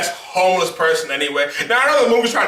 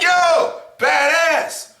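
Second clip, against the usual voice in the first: impressed; 7 semitones higher, much slower than usual. Retold or imitated. Cheering at the screen.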